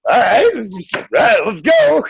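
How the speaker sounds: put-on high-pitched excited child, stretching the words; mock little boy raring to go; eager kid. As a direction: squeal it like a kid ready for a ride. Voicing boy jumping into strangers' car.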